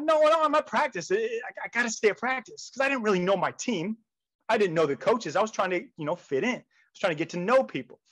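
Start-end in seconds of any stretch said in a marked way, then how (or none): 0.0-2.7 high-pitched flustered protest; acted-out reluctant protest. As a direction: object hurriedly, pleading to be left alone. Protesting being called to the owner.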